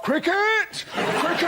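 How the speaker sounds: high, drawn-out cry; Telegraph. Acted. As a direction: call it out high like a one-topic paper. Tell 'em, Telegraph.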